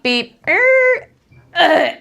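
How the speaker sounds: high electronic beeps, then a squeaky rising tone; imitated hospital monitor beeps. Speaker imitates the hospital monitor for listeners, high electronic beeps. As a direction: mimic a bedside monitor going off. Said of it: "you guys know the sound".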